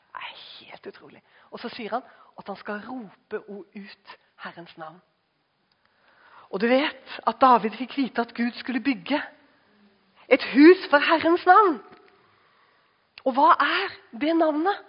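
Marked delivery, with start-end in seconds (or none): 0.1-1.2 whispered in awe; a hushed, amazed aside; awed whisper. Real, not acted. 10.3-14.8 higher and louder, building; preaching climax, higher and louder; rising preaching. Acted.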